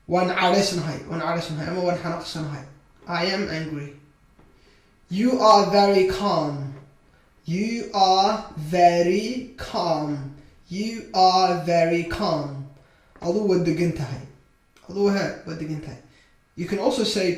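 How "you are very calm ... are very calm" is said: very slow, word by word; slow modelling. Shown as a demonstration. Teacher models a sentence for beginners, slowly and drawn out. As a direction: draw out every word for learners.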